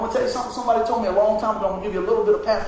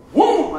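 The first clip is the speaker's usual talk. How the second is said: higher and louder sucked-in gasp; gasping noise; 8 semitones higher, 7 dB louder than usual. Retold or imitated. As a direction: gasp for air like you can't breathe. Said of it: "and I go".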